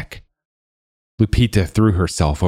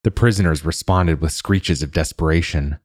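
ordinary delivery, nothing marked

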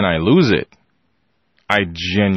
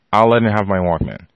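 ordinary talk, nothing marked

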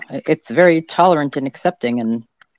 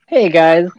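normal talking voice

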